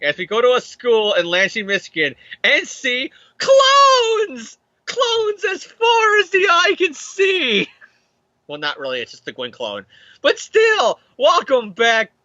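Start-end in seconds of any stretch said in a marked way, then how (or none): 2.4-7.7 high, slow hammed-up wail; over-the-top anguished cry, much higher; mock dramatic cry. Mock dramatic announcement of clones. 10.2-12.1 high, loud ironic greeting; sing-song mock hello to a returning villain; hammed-up welcome back, much higher.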